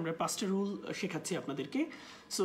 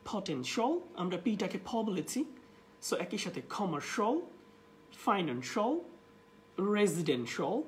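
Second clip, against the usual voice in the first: ordinary talk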